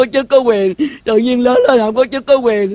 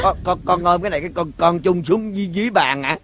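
much softer, fast stammer; hushed fast nervous check; hushed nervous question; 5 semitones lower, 7 dB quieter, faster than usual. Acted. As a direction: stammer it under your breath. Hiding, checking the plan.

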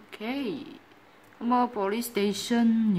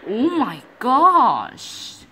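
surprised; 4 semitones higher, 5 dB louder, slower than usual. Real, not acted. Startled by a loud game sound.

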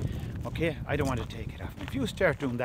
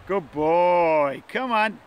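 praising and calling; 3 semitones higher, 6 dB louder, much slower than usual. Real, not acted. Trainer coaxing his dog in, praise rising to a call. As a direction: praise loudly, then lift into a quick call.

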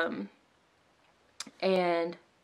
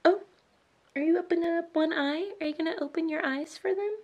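high-pitched cooing to a newborn; sweet mommy voice, well above usual pitch; 11 semitones higher than usual. Real, not acted. Talking to her waking baby.

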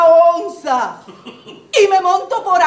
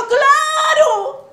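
eager high yes; 7 semitones higher than usual. Acted. Acted quote, eager acceptance.

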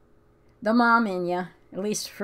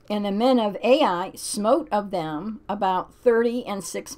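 scripture reading; 3 semitones higher, 5 dB louder than usual. Acted. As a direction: read it like a solemn verse. Reads Bible passage aloud.

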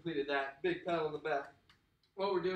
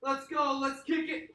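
high, loud pumped-up coaching; hype coach; 8 semitones higher, 7 dB louder than usual. Acted. Workout coach firing up the class, high and loud. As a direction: fire up the class like a trainer.

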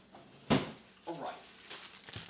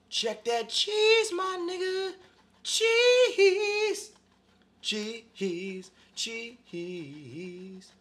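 sing-song the word cheese down; high drawn-out cheese; 4 semitones higher, much slower than usual. Acted. Host admires the grated cheese, stretching cheese high then fading.

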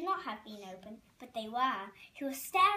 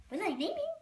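put-on higher indignant question in a French accent; 7 semitones higher, 3 dB louder, faster than usual. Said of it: "A, a French accent".

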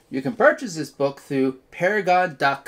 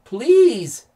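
loud, high, desperate cry; big storybook shout for help; pleading yell; 9 semitones higher, 10 dB louder than usual. A narrator's reading. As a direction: yell it out as a plea. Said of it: "yelled Mark".

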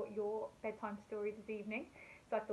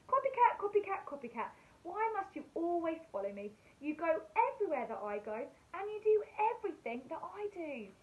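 higher, louder storybook telling-off; cross dog; 6 semitones higher, 5 dB louder than usual. Acted. Dog getting mad at cat for following her, hammed-up scolding. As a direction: scold the copycat, big but kid-friendly.

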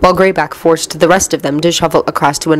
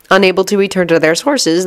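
ordinary delivery, nothing marked

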